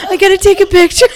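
high, loud words through laughter; squeezing words out mid-laugh; real laughter. Real, not acted. Laughing fit during podcast.

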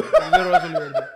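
laughing, high. Real, not acted. Repeating the joke about Alan, high laughing. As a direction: squeal it out cracking up.

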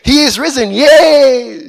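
high, loud triumphant cry; cheering shout. Retold or imitated. Disciples cheering the resurrection plan, loud high cry. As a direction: shout it like a jubilant crowd. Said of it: "They were shouting".

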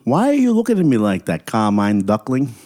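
high-pitched, confrontational New York duckling; indignant challenge. Acted. Little Dominic confronting Carmine at duck school, indignant.

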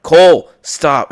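loud, slow, firm stop; shouting at a drunk friend to stop. Retold or imitated. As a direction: bark his name and make him stop. Stopping aggressive friend.

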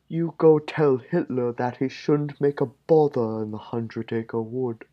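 high, slow bear voice; a sweet cartoon bear rumbling; Pooh Bear voice. Pooh threatening the Nazi, in a high bear voice.

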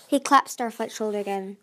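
plain reading voice

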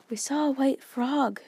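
put-on slow, breathy-sounding boy, a touch out of breath; breathless. A narrator's reading. Seth telling Grandpa about the frog, breathlessly. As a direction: gasp the news out. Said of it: "Seth said breathlessly".

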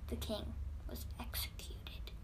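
whispered, soft and grave; dramatic storyteller's whisper; hushed whisper. Acted. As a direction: drop to a whisper for the execution. Dramatic reveal of execution.

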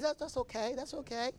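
calm him down gently; lightly voiced reassuring wife; soothing wife. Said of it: "She said".

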